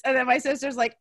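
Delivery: ordinary talk, nothing marked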